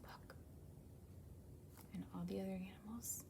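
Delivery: soft whispered aside; conspiratorial whisper. Acted. Reader shares a secret plan with listeners, whispered. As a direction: lean in and whisper.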